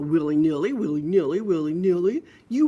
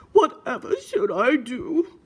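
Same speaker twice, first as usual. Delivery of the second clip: higher, louder, slow storybook dilemma; fretting piggy; 5 semitones higher, 5 dB louder, slower than usual. Acted. Brother piggy wondering what to do, hammed-up fretting. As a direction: play a piglet wondering aloud, drawn out.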